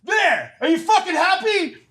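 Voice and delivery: very high, drawn-out retort; mock exasperation. Husband whips off glasses for his wife, high mock exasperation. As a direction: throw it back at her in defiance.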